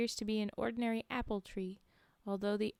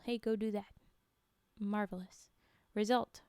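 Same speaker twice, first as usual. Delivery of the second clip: ordinary delivery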